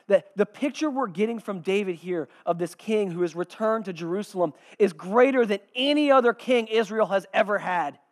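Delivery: ordinary talk, nothing marked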